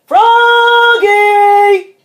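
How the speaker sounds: loud, high, sing-out call; long hollered name; dad calling. A narrator's reading. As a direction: call the child from afar, stretching the name. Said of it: "called Dad".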